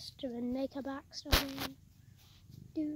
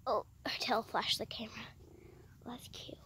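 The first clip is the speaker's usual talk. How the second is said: soft unvoiced whisper; 3 semitones lower, 5 dB quieter than usual. Real, not acted. Kid whispering beside the cat, hushed. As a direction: hush it so the cat stays calm. Aside while filming the cat.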